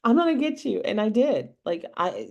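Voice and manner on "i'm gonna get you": higher, louder teasing tone; mock playful threat, raised pitch; playful retold threat. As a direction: tease him like you'll make him cry. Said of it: "it's like".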